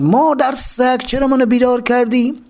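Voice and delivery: grumble-shout at being woken, lightly acted; woken angry shout. Truck driver woken in the cell yelling, mild mock yell. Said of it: "داد و قالش در اومد".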